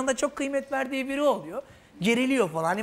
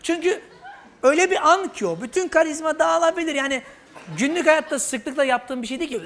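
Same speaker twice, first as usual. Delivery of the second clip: ordinary talk, nothing marked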